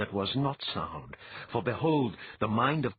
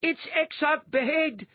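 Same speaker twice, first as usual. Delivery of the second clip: high, loud proclaiming voice; a disguised priest stirring the crowd, loud and high; declaring priest; 5 dB louder than usual. A narrator's reading. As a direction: announce him to the crowd. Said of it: "a disguised grey robe priest declared".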